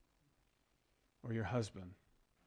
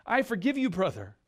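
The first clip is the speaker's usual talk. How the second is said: cried out; 6 semitones higher, 4 dB louder than usual. A narrator's reading. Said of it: "I cried".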